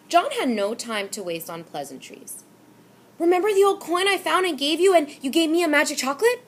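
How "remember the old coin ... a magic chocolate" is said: loud, high, fast demand. A narrator's reading. Boy confronts the storekeeper, loud, high and fast. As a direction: fire it at him, high and loud. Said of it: "he demanded".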